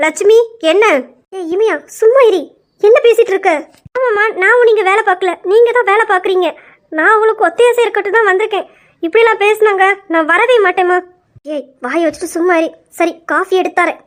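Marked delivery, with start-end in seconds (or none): 0.1-3.5 sharp, louder, higher rebuke; lady of the house yelling at the bickering maids; scolding. Acted. Mistress scolds quarrelling servants. 4.0-11.0 offended maid tearfully complaining to her mistress; tearful complaint. Acted.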